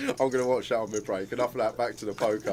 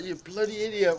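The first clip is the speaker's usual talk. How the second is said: higher imitated Scottish telling-off; mock Scottish accent; 9 semitones higher than usual. Scottish bloke scolding mate hit by bus, mock Scottish accent. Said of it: "thick Scottish accent".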